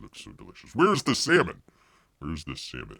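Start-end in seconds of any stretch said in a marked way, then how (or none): 2.2-3.0 softer, lower repeat; quiet. Shown as a demonstration. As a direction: mutter it to yourself. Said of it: "repeated quieter".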